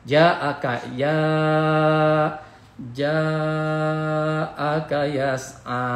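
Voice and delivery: slow chant, "ja" held very long; mad recitation. Shown as a demonstration. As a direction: stretch the vowel in "ja'aka". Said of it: "dibaca lima harokat".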